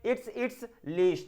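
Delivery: "it's, it's leash" very slow; slow model answer. Shown as a demonstration. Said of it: "यह इसका पट्टा है". (बहुत धीरे "it's, it's leash")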